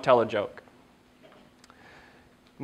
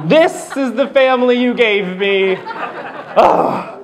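higher, laughing disbelief; mock exasperation; 8 semitones higher, much slower than usual. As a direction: sound fed up with family. Voicing the annoyed guy in photo.

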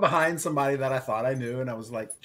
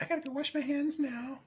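high, small squeamish voice; mock squeamish; 7 dB quieter than usual. Man who tickled a stranger's armpit, high mock squeamish. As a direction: squeak it softly, half laughing.

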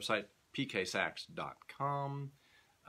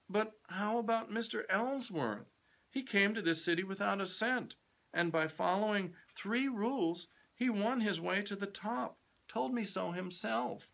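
put-on high, eager young man's voice; a keen young clerk pressing his point; earnest young man, pitched high; young Kennedy; 10 semitones higher than usual.

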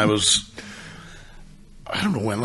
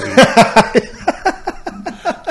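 squealing high laugh; high shrieking laugh; 6 dB louder than usual. Real, not acted. Friend hearing the fake dean story, high squealing laugh. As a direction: burst into a shrill cackle.

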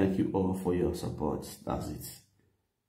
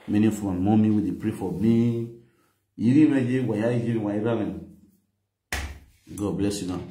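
ordinary delivery

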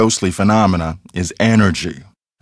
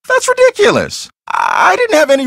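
shrill high cry of disbelief, much higher; indignant outburst. Acted. Accused of pulling the brake, high indignant cry. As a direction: fling it back as outrageous.

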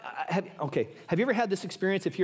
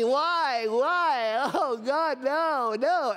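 drawn-out mock wail of a little boy; hammed-up pitiful lament; crying out to God; 5 semitones higher, much slower than usual. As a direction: imitate a kid's melodramatic plea. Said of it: "he's crying out to God".

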